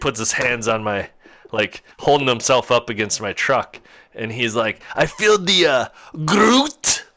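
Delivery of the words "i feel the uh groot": high imitated old Dutchman, halting then blurting; Dutch accent. Old man leaning on the truck gropes for words, Dutch accent. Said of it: "that's definitely what the accent sounded like".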